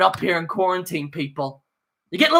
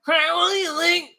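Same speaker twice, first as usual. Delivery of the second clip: mock Seth Meyers; 8 semitones higher than usual. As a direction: drag out the question like a hammy host. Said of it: "Seth Meyers, that guy".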